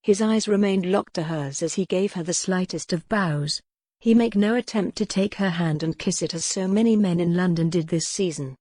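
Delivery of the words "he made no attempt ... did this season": usual speaking voice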